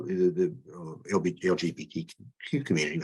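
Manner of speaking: stumbling over the letters with false starts; tongue-tied. Real, not acted. Candidate fumbling the community's name in an interview, tongue-tied. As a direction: trip over the initials and restart haltingly. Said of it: "I'm tongue-tied all of a sudden".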